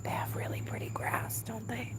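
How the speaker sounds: hushed whisper so no one hears. Real, not acted. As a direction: whisper it secretly. Whispering while filming neighbor's yard.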